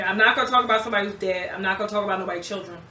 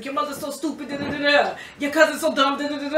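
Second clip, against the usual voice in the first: teasing friend; 4 semitones higher than usual. Retold or imitated. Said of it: "He said".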